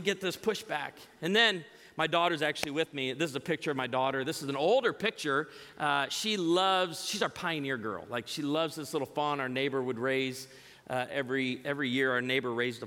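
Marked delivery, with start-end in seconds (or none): none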